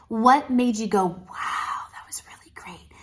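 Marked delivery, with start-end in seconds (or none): 1.1-2.9 whisper. Pretend. Inner reaction of a viewer.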